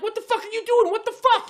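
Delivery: high-pitched retold outburst; cussing out. Driver cussing at woman cutting in, high outraged yelling. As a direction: shriek it in acted road rage. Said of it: "I start cussing".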